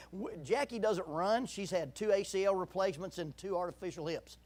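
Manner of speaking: ordinary talk, nothing marked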